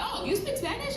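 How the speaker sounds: high-pitched surprised question; pleasant surprise. Retold or imitated. Consular officer to applicant who answers in Spanish, pleasantly surprised.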